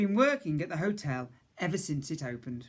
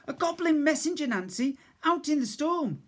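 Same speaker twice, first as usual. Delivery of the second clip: much higher, louder urgent cry; boy's shout; 11 semitones higher, 4 dB louder than usual. A narrator's reading. Boy shouts the news to the cook. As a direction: push the warning out. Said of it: "I finally managed to shout".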